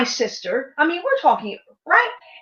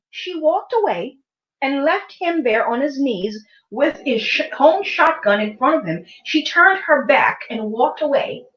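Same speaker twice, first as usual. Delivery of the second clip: ordinary talk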